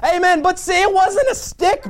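ordinary delivery

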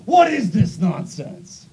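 slow, loud furious roar; hammed-up furious outburst; furious Naaman. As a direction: bellow it red-faced and offended. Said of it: "red in the face and says".

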